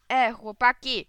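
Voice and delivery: put-on loud call to the boys; shouting. A narrator's reading. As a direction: holler to friends across the schoolyard. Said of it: "krzyknął Alces". (głośne wołanie do kolegów)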